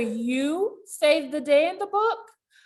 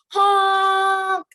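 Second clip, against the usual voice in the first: loud high honk; playful horn noise for kids; horn honk imitation; 7 semitones higher, 6 dB louder than usual.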